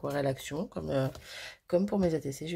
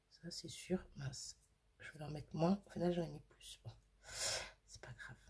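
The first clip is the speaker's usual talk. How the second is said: marmonné à mi-voix, presque soufflé; chuchoté pour soi; 13 dB quieter than usual. Real, not acted. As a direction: se murmure à soi-même en travaillant. Se parle à elle-même en peignant. (chuchotement pour elle-même)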